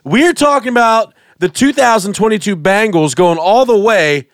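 high, slow hype announcer; pumped-up host intro. Acted. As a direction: build it up like a game-day host. Hyping Super Bowl segment.

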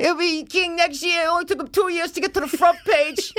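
very high, far cartoonish voice; high silly voice. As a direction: keep the squeaky bit going. News bit high voice.

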